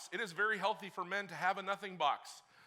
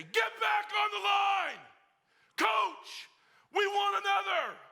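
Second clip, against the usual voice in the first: loud, much higher defiant yell; captains shouting; 9 dB louder, slower than usual. Retold or imitated. Captains shouting at the coach for another gasser. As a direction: yell it across the field. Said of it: "they say".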